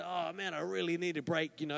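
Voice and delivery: soft, tired mock complaint; a drained worker imitated, softly; quiet, fed-up moan before a holiday; weary sigh. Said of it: "just before the holiday".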